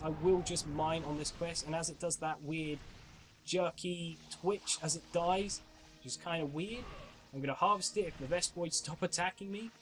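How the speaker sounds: usual speaking voice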